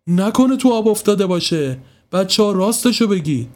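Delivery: a hint of a fearful father; mild worry. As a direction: press the kids, worried. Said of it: "نگاهی نگران".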